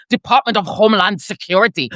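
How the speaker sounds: mock German-accented official title. Shown as a demonstration. Showing listeners how Nazi the agency name sounds, German accent. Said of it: "Say it in the German accent".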